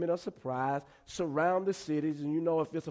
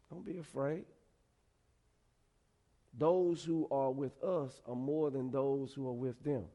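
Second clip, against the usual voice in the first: calm voice; 2 semitones lower, 5 dB quieter than usual. Acted. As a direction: play the unshaken prophet, quietly. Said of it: "I imagine him in a calm voice".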